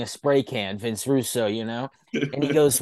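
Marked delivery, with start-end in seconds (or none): none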